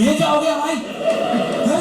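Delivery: high mock-panicked gasp of disbelief. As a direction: ham up the friends' panic, voice pitched high. Friends panic imitated.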